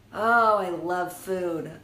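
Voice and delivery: slow loud swoon over food. Real, not acted.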